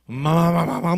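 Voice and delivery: loud, high mock scolding babble; angry daughter's rant. Pastor imitating the angry daughter on the phone. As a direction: rattle it off like a furious daughter. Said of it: "따님이 막 화가 이만큼 나가지고".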